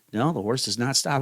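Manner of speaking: normal talking voice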